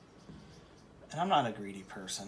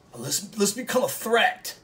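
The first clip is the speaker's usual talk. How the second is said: higher and louder, building to a shout on art; rallying call; 7 semitones higher, 7 dB louder than usual. Real, not acted. Painter rallying viewers against big YouTubers, building to a shout. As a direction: pump up the crowd, rising to a yell.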